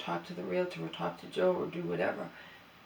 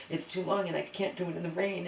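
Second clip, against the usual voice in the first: normal talking voice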